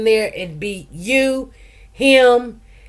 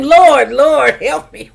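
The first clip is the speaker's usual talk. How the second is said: louder, higher cry of "Lord, Lord, help me"; calling on the Lord; 5 semitones higher, 6 dB louder, slower than usual. Shown as a demonstration. Urging listeners to call on God, a louder crying out. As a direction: call his name out boldly. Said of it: "just call his name".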